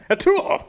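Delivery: high, loud fake sneeze; mock sneeze. Acting confused sneezing character.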